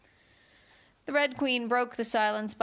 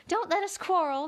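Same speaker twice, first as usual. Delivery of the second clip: anxious; 8 semitones higher than usual. A narrator's reading. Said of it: "in an anxious tone".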